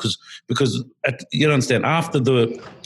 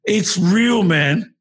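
excited; 8 semitones higher, 4 dB louder, much slower than usual. Real, not acted. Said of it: "excited realization".

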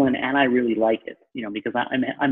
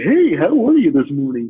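a bit higher, louder, sing-song cheery hello; mock chipper greeting; 4 semitones higher, 6 dB louder than usual. Broker mocking the happy-go-lucky type, in a bright mock greeting. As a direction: lightly imitate the happy-go-lucky morning person. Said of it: "I'm not the happy-go-lucky".

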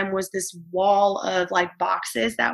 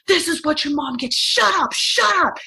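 much higher, louder mock yell; retold angry yell; 7 semitones higher, 4 dB louder than usual. Mother imitating her attacker yelling at her sons, angry yell. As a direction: bark it at the crying kids. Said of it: "He started yelling at them".

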